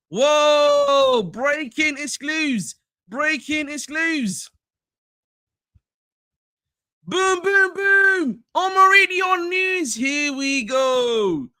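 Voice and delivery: slow, drawn-out, high breaking-news hype; hammed-up reporter's here we go shout; hyped transfer-news announcer. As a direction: announce it like the big exclusive just dropped.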